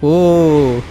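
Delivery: normal talking voice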